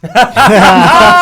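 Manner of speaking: big high burst of laughter; cracking up loudly. Real, not acted. Laughing hard at his own EastEnders joke.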